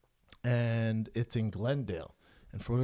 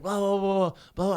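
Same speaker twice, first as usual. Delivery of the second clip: loud, high mock rant in blahs; raised nonsense tirade; frantic yelling; 7 semitones higher, 9 dB louder than usual. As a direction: imitate a shouting driver without real words. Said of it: "He's yelling, frantic".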